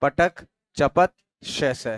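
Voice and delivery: पटक, चपत said slowly and emphatically; slow listing. Shown as a demonstration. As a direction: read each word slowly to the class. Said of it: "बोलना कौन कौन से?".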